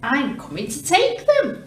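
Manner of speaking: yelled. Acted. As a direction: shout it as he charges in. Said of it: "the rat thief yelled".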